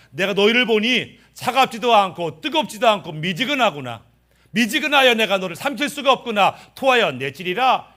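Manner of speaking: high, loud stern rebuke; rebuking Jesus. Acted. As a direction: scold the lukewarm church. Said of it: "예수님께서 라오디게아 교회에게 뭐라고 말해요". (미지근한 교회를 책망하는 높고 큰 목소리)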